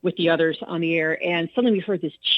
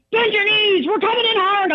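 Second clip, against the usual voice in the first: pilot's warning; 12 semitones higher, 4 dB louder than usual. Retold or imitated. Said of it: "the pilot's going".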